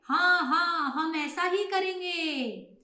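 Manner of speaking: high, loud first yes; keen storybook chorus reply; flock of pigeons agreeing. Acted. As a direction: agree eagerly as one flock. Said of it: "तो सभी कबूतर बोले".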